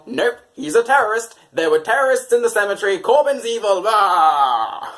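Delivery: mocking opponents. Corbyn's opponents mocked in a loud blustering rant. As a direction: parody a blustering politician. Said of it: "And Corbyn's opponents have gone".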